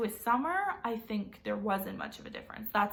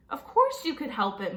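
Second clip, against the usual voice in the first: scolding; 4 semitones higher, 6 dB louder than usual. Acted. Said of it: "Mom scolded".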